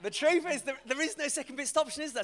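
high, loud mock exasperation; exasperated retort. Preacher retelling his answer to his friend Dave, exasperated. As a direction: burst out with a pleading correction. Flustered reply to friend's question.

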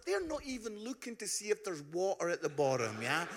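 ordinary talk

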